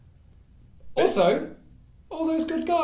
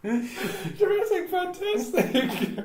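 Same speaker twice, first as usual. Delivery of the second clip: laughing; 13 semitones higher, much slower than usual. Real, not acted. Laughing at the name.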